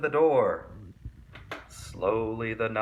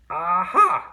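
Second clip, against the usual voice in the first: triumphant aha; 6 semitones higher, 8 dB louder than usual. A narrator's reading. Swamp finds the mask.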